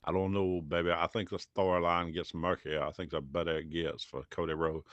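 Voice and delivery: low, soft wrestler impersonation; Dusty Rhodes. Acted. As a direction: put on a bad impersonation, low and hushed. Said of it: "world's worst Dusty Rhodes impersonation".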